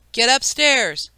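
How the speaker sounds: a father ordering his son away; angry yell. A narrator's reading. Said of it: "Peter yelled".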